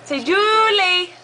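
put-on slow, high coaxing of a toddler; sing-song prompt. Shown as a demonstration. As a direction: stretch out the name Julie on a high pitch. Parent repeats prompt, stretching the name.

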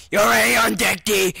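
high, loud outburst; mock yell. Player spots Daryl on deck, sudden shout.

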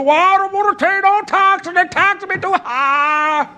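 very high, loud mimicking whine; hammed-up protest, far above your own pitch; mocking complainer. Preacher mimicking someone who won't pay tax, mocking complaint.

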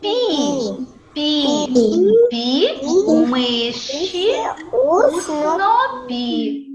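separated syllables, very slow stretched ending; tongue-twister drill. Shown as a demonstration. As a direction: lead pupils in a drawn-out chant. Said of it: "Давайте повторимо".

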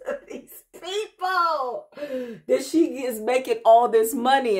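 high, slow words bubbling with laughter; giggly amused telling; laughing. Real, not acted. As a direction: laugh through it, amused at them. Laughing while recalling coworkers.

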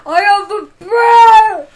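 screamed bear line at full blast; shouting. Acted. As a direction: yell it like a roaring kid. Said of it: "Don't shout".

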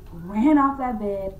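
normal talking voice

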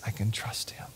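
soft whispered reply; whispered trust. Retold or imitated. Believer answering Jesus, soft whisper. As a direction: whisper it in quiet surrender.

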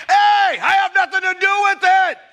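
loud and high, throwing up his hands; indignant shout. Acted. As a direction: shout it back at the critics: not my fault. Protesting innocence to critics.

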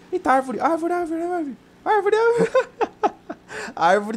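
climbing high excited "árvore" cries; excited yelps. Real, not acted. Gamer shouting to his teammate about a tree, high and excited. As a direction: yelp at the tree as it falls.

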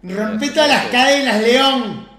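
loud, high cheering roar; hype shout. Real, not acted. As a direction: roar a teammate on. Cheering clutch play. (grito de aliento)

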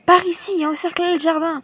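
high-pitched urgent cries; cried out. A narrator's reading. Policemen closing in on the garden, urgent high cries. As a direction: call out commands, pitched well up. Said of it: "des cris retentirent".

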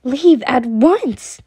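loud, drawn-out command; angry dismissal. A narrator's reading. Mother sending the servant girl out, loud angry dismissal. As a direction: order them out forcefully.

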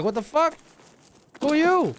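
lightly acted startled challenge, pitch jumping up on the question; startled army reaction. Soldiers confronting a stranger in full gear at the door, mildly startled. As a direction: challenge a stranger as if taken aback. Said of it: "They'd be like".

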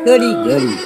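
loud gleeful cry; clap-your-hands glee; gleeful Daisy. A narrator's reading. Daisy celebrating the saved farm, gleeful and loud. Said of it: "says Daisy".

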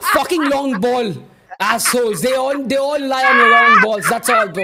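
loud angry rant. Real, not acted. Fan ranting about long balls.

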